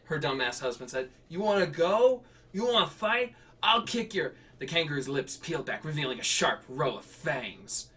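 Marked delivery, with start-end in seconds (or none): 1.3-4.4 put-on high, loud drunk taunting; drunk picking a fight. Husband drunkenly challenges the kangaroo, high loud taunt. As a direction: taunt like a tipsy brawler.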